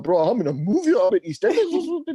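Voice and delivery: high, loud, thrilled disbelief; rising to a starstruck squeal; amazed. Retold or imitated. Lad in a London cab feeling on TV, amazed.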